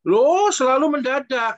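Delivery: emphatic exclamation. Real, not acted. Pastor exclaims to counseled aunt.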